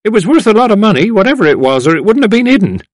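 loud, high character voice; elder arguing. A narrator's reading. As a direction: butt in loudly to reason it out. Another elder argues.

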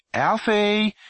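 high loud call to a son upstairs; mother shouting up to the attic; calling up the stairs. A narrator's reading. Mother calling her son from downstairs, loud. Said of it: "his mother called from downstairs".

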